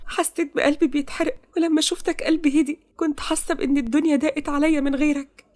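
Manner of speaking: high-pitched, aching confession; emotional heroine. Acted. Heroine tells her beloved how she suffered, emotional.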